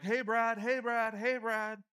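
high, repeated call across a street; yelling a name. Acted. Yelling to a friend across the street, calling out. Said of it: "You might yell their name over and over again".

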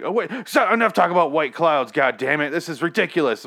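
loud, high mock-exasperated yell; imitation of an indignant officer blowing up; exasperated outburst. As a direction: act out losing patience, high and loud. Acts out character's exasperated outburst.

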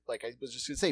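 usual speaking voice

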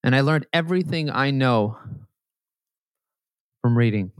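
emotional. Real, not acted. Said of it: "so I get very emotional here".

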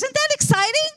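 high, bright rising call to the congregation; excited question. Real, not acted. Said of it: "Oh, come on".

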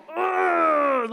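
strained mock growl "urgh"; worked-up growl. Woman fired up at man in next car, mock growl. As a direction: growl it out, hammed-up fury.

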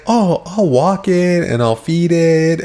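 mock eager pleading, stretched out slowly; pleading boyfriend. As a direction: imitate someone begging for a puppy. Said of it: "he's talking like it's a puppy".

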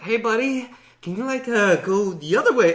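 meekly begging Jason to leave; pleading player. Retold or imitated. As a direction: coax the killer to go elsewhere. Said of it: "'Cause it's like".

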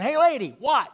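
high parrot call-out. Acted. Parrot spotting the lady again, high mock call. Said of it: "The parrot sees her again".

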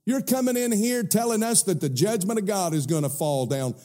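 high, laughing scornful voice; mocking sons-in-law. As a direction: sneer and laugh at the old man.

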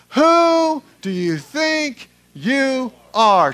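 loud, high, slow chant-like challenge; out loud together. Shown as a demonstration. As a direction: lead a crowd in shouting it, word by word. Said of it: "Let's say that out loud together".

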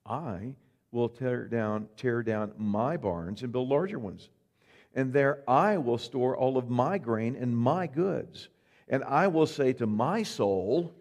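slow, proud, leaning on every I; a rich man pleased with his own plans; slow boastful planner; self-satisfied rich man. Acted. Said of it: "Then he said".

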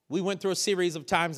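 usual speaking voice

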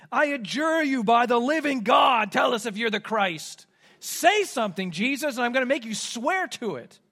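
a forceful, loud demand from the high priest. Retold or imitated. High priest Caiaphas confronting silent Jesus, loud and demanding. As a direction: bark the order like an angry judge.

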